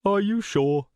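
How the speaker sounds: high, airy question; lifted, probing tone; insinuating villain. A narrator's reading. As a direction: press her with feigned politeness. Villain presses her after refusal.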